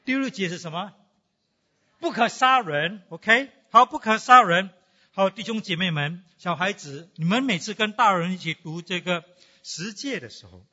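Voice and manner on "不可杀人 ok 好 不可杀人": loud, higher proclaiming of the commandment; preacher drilling the sixth commandment into the congregation; emphatic call-out. Real, not acted. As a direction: call it out to the whole room.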